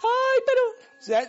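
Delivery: hammed-up tearful lament; mock crying. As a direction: whimper it like a sorry crybaby. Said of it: "Y después uno llorando".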